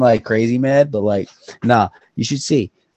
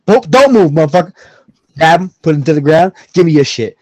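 loud, raised, forceful commands; play-acted robbery threat; 7 semitones higher, 10 dB louder than usual. Robber ordering a victim down during pretend hold-up, forceful shouting. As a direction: order them around like a hold-up in progress. Acting out an armed robbery scene.

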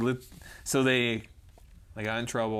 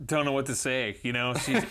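usual speaking voice

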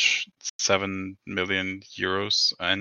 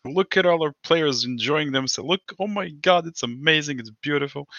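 pitched up, gushing like a tourism advert; mock promo gush; 6 semitones higher than usual. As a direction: oversell the resort like a cheesy promo. Imagined club promo for resort.

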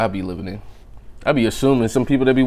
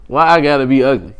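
normal talking voice

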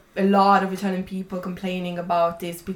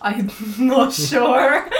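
laughing; 5 semitones higher, 7 dB louder, slower than usual. Real, not acted. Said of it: "Is impactful a word?".

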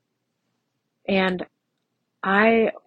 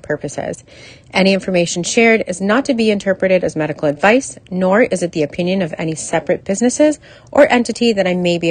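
louder, projected legal announcement; disclaimer read; 6 dB louder than usual. Real, not acted. Podcast host reading the legal stuff to listeners, louder formal read. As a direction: read out the legal notice in a firm, raised voice.

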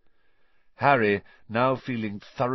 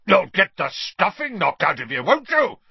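higher, louder furious roar rising to a shriek; 10 semitones higher, 8 dB louder than usual. A narrator's reading. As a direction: threaten him at full volume. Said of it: "roared Uncle Vernon".

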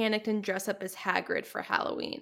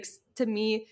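usual speaking voice

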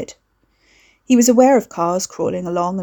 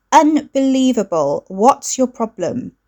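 exasperated sister; 5 semitones higher, 4 dB louder, slower than usual. A narrator's reading.

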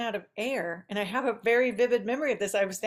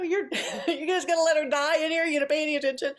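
laughing mock yell, higher and a touch louder; imitating dad yelling; 7 semitones higher, 4 dB louder, faster than usual. Dad yelling at mom by the pool, laughing mock yell. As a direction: play the big dad scolding, half-laughing. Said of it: "is yelling at my mother".

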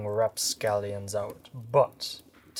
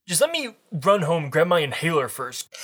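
put-on higher, fast nervous teen; teen boy voice; 6 semitones higher, 9 dB louder, faster than usual. Voicing Todd.

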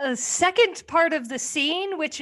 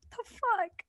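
incredulous; 8 semitones higher, 5 dB quieter than usual. Retold or imitated. Reacting to Jill Clayburgh's line.

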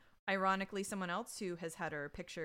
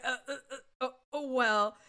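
mock flustered stammer, louder and higher; losing all chutzpah; 5 semitones higher, 7 dB louder than usual. Dad caught reading daughter's emails, flustered mock stammering. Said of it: "Loses all chutzpah".

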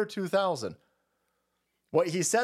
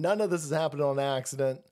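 ordinary talk

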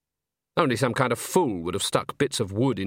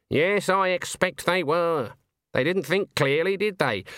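higher-pitched stern witch voice; Granny, dismissive; 5 semitones higher than usual. Acted. Granny explains away the sword trick to Nanny, dismissive. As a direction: dismiss foolish people in a raised character voice.

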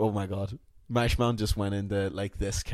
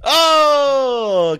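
loud, high, long "oh"; a big stretched-out cry of "oh"; drawn-out oh; 12 semitones higher, 11 dB louder than usual. Pretend. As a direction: belt out one long high "oh". Mock reaction to impressions game.

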